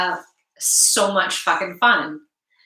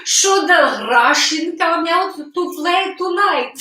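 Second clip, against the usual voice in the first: put-on light playful Russian accent, pitched up; mock Russian persona, lightly done; 6 semitones higher than usual. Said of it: "my Russian persona".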